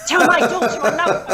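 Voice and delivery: imitated spirit calling out. Spirit pestering medium in shopping malls, mock urgent call.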